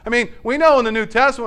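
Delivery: ordinary talk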